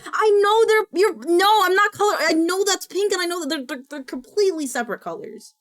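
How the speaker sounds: loud, high, fast flustered denial; indignant protest. Real, not acted. Streamer denying being colorblind to chat, indignant protest. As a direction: protest loudly, stumbling over the words, then ease off.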